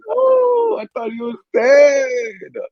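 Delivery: very high, drawn-out outcry; hammed-up cry, stretching the last word; mock wailing. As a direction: wail it in exaggerated disbelief. Retold outcry at dream twist.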